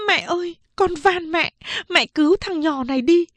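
high, tearful plea; crying while speaking. Acted. Second sister kneeling, crying and begging mother to save the boy. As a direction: weep as you beg. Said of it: "vừa khóc vừa nói".

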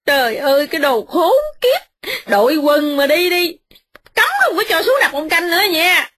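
high, tearful ranting wife; wife ranting endlessly at her husband; ranting young wife. Acted. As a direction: rail on and on, near tears. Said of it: "mợ nói mấy giờ đồng hồ".

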